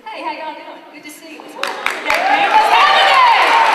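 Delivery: building from easy hello to a high booming welcome; loud friendly greeting. Acted. Coach acting a confident entrance, building to booming greeting. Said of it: "when you walk in there like this".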